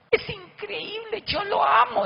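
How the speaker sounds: high, swooning gush about the boss. Retold or imitated. The wife gushing adoringly about her boss, high and swooning.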